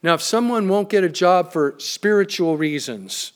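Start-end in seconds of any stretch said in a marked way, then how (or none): 1.8-3.3 "spiritual reasons" set apart in mock quotes; audible air quotes. Real, not acted. Preacher doubting excuses for not working, audible air quotes. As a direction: put sceptical quote marks round "spiritual reasons". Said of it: "notice I'm using air quotes".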